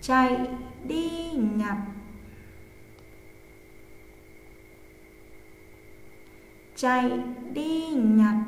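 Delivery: slow, word by word dictation; dictating. Shown as a demonstration. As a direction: read slowly for pupils to write. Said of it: "cô giáo sẽ đọc, hai đến ba lần".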